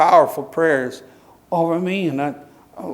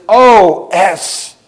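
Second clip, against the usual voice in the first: mock loud curse; 3 semitones higher, 6 dB louder than usual. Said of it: "She says the S word really loud".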